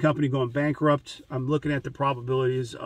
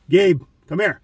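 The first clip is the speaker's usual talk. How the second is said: calling the dog; 4 semitones higher, 9 dB louder than usual. Retold or imitated. Calling his German Shepherd.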